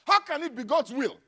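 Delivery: ordinary talk, nothing marked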